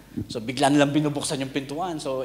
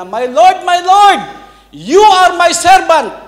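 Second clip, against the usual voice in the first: very high, loud shout, slowing on "you are my servant"; frightened shout; 14 dB louder, slower than usual. Retold or imitated. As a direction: blurt it out, yelling in panic. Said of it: "Sigaw siya ng gano'n". (sumisigaw nang malakas at natataranta)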